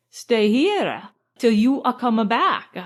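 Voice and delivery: put-on slow foreign accent with added vowels; deliberate foreign-accented English; careful foreign accent. A narrator's reading. Count offering to wait in the room, careful foreign accent. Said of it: "in careful English with an accent".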